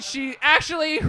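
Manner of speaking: ordinary delivery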